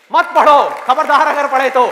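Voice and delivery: loud, high forbidding shout; stern warning. Acted. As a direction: bellow it as a threat. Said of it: "पूरी दुनिया आकर के आपको कहे कि मत पढ़ो".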